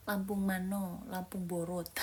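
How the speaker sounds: ordinary talk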